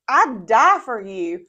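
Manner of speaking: loud, drawn-out declaration; Peter. Retold or imitated. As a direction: declare devotion slowly and emphatically. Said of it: "Peter comes in and he's like".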